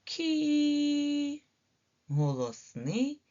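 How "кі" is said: кі held long and high; drawn-out ki. Shown as a demonstration. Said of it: "м'який".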